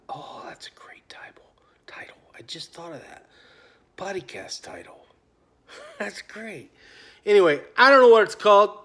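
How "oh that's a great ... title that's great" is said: hushed private aside with a soft laugh; whispered aside. Real, not acted. Vlogger to himself over a podcast title, whispering. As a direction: whisper it softly to yourself, delighted.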